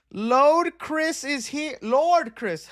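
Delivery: drawn out, slow and laughing; slowly laughing out a viewer's name. Real, not acted. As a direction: laugh through the greeting.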